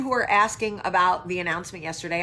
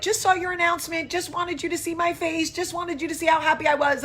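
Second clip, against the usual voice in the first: gushing friend; 10 semitones higher, 4 dB louder, faster than usual. Retold or imitated. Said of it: "a friend in Freehold who was like".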